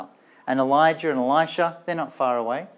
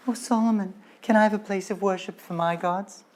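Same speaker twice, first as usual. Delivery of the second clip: lightly voiced wives, higher and wheedling; pleading wives; 6 semitones higher than usual. Wives begging Solomon for shrines, mild mock wheedling. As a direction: coax Solomon in a hint of a sweet pleading voice. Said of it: "they're all saying".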